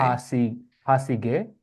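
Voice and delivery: slow, clear syllables for a learner. Shown as a demonstration. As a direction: teach each syllable slowly. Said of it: "give it to me syllable by syllable".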